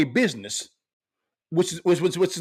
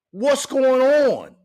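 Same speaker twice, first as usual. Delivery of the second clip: very slow, stretched question; drawn-out refrain; 3 semitones higher, 4 dB louder, much slower than usual. Real, not acted. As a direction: draw every word out, pointed. Rhetorical refrain in advice.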